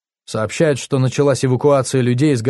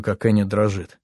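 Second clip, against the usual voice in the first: plain reading voice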